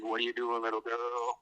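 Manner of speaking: Manson's creepy voice. Retold or imitated. Manson teasing his friend on the phone, lightly creepy put-on voice. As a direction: tease like a creep on the phone, half-joking. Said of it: "like, the accent thing".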